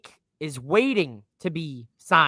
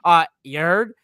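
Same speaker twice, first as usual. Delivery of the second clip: usual speaking voice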